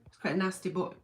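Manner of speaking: usual speaking voice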